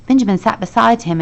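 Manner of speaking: plain reading voice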